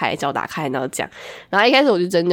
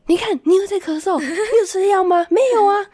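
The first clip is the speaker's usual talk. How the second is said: nagging mom; 13 semitones higher than usual. Retold or imitated. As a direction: imitate mom nagging about medicine. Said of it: "我妈说".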